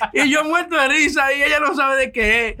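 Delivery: laughing at his own story. Real, not acted. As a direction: talk while cracking up. Laughing while telling the story.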